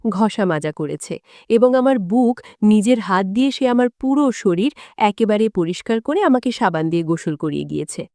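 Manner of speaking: plain reading voice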